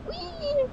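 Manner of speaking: very high-pitched "whee"; squealed whee, far above speaking pitch; imitated take-off noise. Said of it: "I heard it was like, massive taking off".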